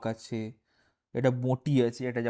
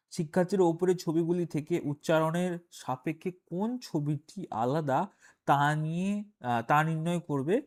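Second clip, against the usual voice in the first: reading the exercise text in a slightly raised voice; measured textbook reading, a little higher; reading aloud; 4 semitones higher than usual. Acted. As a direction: read out the task from the page. Reads task text from book.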